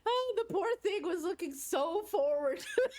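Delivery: high, squeaky-with-laughter sympathy; real laughing pity. Real, not acted. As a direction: laugh helplessly while feeling sorry for her. Teller laughing in pity for friend.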